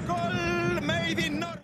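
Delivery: very high, very slow; drawn-out goal tagline. Real, not acted. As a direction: stretch out the tagline. Commentator's punchline after the goal call.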